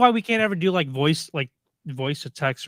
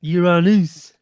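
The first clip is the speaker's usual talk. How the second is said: loud mock-French "Uranus"; hammed-up French pronunciation; mock French accent; 3 semitones higher, 10 dB louder, slower than usual. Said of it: "Is it French?".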